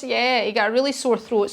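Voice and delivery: ordinary talk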